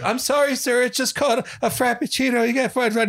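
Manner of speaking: put-on high-pitched meek kid voice; mock apologetic kid to an angry customer, high and meek; timid kid. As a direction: squeak it out like a nervous young barista. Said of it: "the kid's like".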